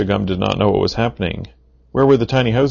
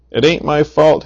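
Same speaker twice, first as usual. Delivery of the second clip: yelling; 7 semitones higher than usual. A narrator's reading. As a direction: shout it, cornered and scared. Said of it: "he yelled".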